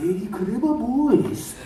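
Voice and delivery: high, slow, exaggerated Japanese accent; hammed-up Japanese anime fanboy; thick Japanese accent. Shown as a demonstration. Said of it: "can you do a Japanese accent?".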